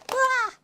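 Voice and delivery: exasperated cry. Real, not acted. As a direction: let out a fed-up yell. Exasperated at all the hate.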